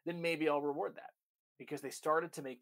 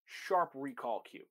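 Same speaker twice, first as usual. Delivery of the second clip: sharp cue; 5 dB louder, much slower than usual. Shown as a demonstration. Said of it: "I might have a sharp, sharp recall cue".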